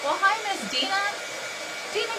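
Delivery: excited greeting. Real, not acted. Greeting a viewer in a high singsong. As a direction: sing out a delighted hello.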